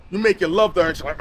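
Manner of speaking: ordinary talk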